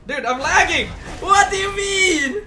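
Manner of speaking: loud, high, laughing disbelief; hyped gamer bro. Real, not acted. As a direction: yell at the lag. Lag spike mid-fight.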